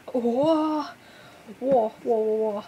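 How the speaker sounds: loud, thrilled exclamations; excited whoa. Real, not acted. Fan thrilled at her first look inside the photo book. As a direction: burst out with delight.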